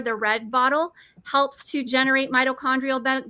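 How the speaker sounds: ordinary talk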